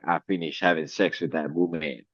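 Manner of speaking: hammed-up cartoon killer, pitched low; cartoon villain impression. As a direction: start low and hushed, punch the ending like a cartoon. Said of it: "make it like Pepe Le Pew".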